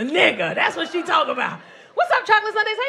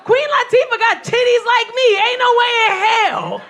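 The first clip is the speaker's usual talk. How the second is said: loud, higher outraged yell; loud disbelief; 8 semitones higher, 6 dB louder than usual. Retold or imitated. Comic yelling at [name] trailer, loud outraged disbelief. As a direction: holler at the screen in disbelief. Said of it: "And I said".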